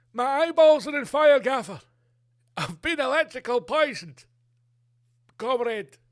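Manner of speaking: high, loud cries of a man on fire; burning victim. Retold or imitated. A Russian victim howls that his eyes burn. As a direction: yell in agony from the poison.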